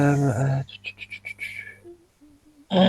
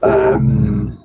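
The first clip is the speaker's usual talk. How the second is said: a hint of dragon voice modulation, low; talking through the voice changer by mistake; 8 semitones lower, 3 dB louder than usual. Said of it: "I did that in the wrong voice".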